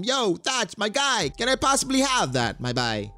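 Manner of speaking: high-pitched put-on accent for Teach. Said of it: "why I gave him that accent".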